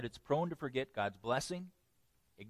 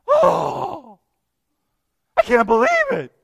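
shrill, much higher and louder wail of "I can't believe it"; drama queen; 14 dB louder than usual. Retold or imitated. Preacher mocks a drama queen, hammed-up high wail. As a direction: ham up an overdramatic whine. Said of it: "a drama queen".

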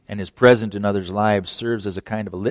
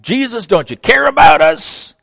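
panicked cry; 12 semitones higher, 9 dB louder than usual. Acted. Disciples cry out in storm.

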